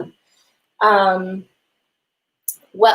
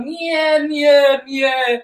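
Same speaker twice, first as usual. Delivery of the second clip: ordinary talk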